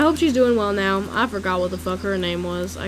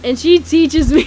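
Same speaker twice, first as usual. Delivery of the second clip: loud, higher, about to crack up; breaking into laughter; 5 semitones higher, 4 dB louder than usual. Real, not acted. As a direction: lose it laughing as the words end. Cracks up telling the story.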